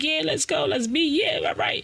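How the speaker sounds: high, eager mock hype. Retelling to friends, her own eager yes.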